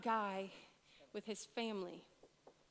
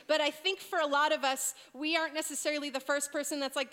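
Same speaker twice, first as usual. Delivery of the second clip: ordinary talk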